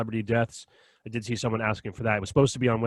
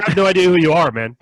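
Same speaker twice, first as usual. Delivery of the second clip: sharply raised, louder protest; loud exasperated retort; 5 semitones higher, 9 dB louder than usual. Retold or imitated. To a stranger who mistook him, a loud annoyed retort. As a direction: tell the stranger off loudly.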